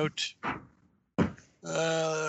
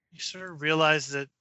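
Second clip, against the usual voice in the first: ordinary delivery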